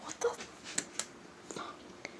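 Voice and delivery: hushed, scared whisper; frightened whisper. Real, not acted. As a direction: whisper it, terrified someone's there. Hears somebody else outside.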